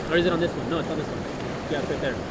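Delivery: quietly checking his own arm for the scar; muttering to himself. Real, not acted. Man checking his arm for the scar, talking to himself under his breath.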